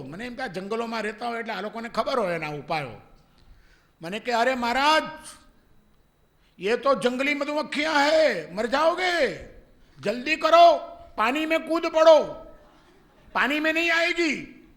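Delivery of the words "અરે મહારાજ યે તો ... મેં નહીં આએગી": high, loud, hurried warning; urgent warning. Retold or imitated. Village boy urging the monk to jump in the water, high loud alarm. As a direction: urge him to jump in now.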